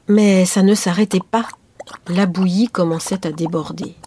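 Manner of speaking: ordinary delivery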